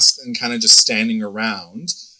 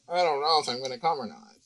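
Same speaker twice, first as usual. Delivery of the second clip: soft, sulky reply; sulky murmur; 10 dB quieter than usual. Retold or imitated. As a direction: mumble it as the hurt girlfriend. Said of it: "She's like".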